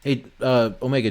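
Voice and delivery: ordinary talk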